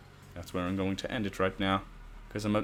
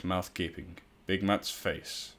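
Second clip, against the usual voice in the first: usual speaking voice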